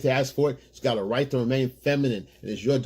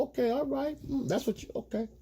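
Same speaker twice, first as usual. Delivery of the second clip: higher and softer, trailing off to a near whisper; mock indifferent agreement; 8 semitones higher, 4 dB quieter than usual. Letting her vent, answering with bored okays. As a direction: nod along with no real interest. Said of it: "listen and go".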